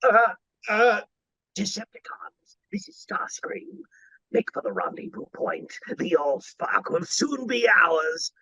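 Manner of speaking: high, hushed light British villain voice; quiet, scheming British robot commander; light British Starscream. Shown as a demonstration. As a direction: command the Decepticons in a soft, sly British accent. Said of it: "you want Starscream to sound like this".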